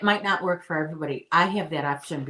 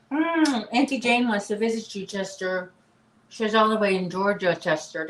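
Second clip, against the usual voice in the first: higher, louder sing-song voice for the dog; pet talk; 4 semitones higher, 4 dB louder than usual. Real, not acted. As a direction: coo at the puppy. Talking baby talk to the dog.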